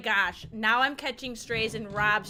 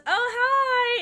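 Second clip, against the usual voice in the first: a high, bright mock hello; chirpy greeting; 13 semitones higher, 6 dB louder than usual. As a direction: chirp it like a cheery friend. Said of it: "she goes".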